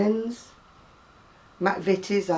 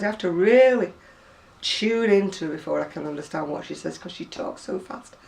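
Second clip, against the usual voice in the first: ordinary delivery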